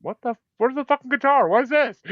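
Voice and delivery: high-pitched what-is-this disbelief; shocked disbelief. Retold or imitated. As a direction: act stunned that the guitar is gone. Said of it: "I'm like".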